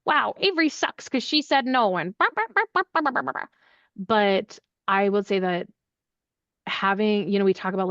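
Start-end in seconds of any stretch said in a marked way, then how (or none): 0.1-3.5 high mocking complaint voice; high, whiny mock complaint; mock complaining people. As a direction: mimic people whining about Avery. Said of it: "people going".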